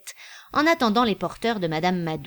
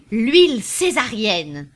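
louder, slow, ringing out the name; proud cry; 3 semitones higher, 6 dB louder, slower than usual. A narrator's reading. Popinot crying out the oil's new name. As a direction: announce the product like a banner. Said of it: "cria Popinot".

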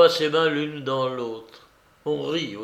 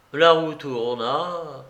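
ordinary delivery

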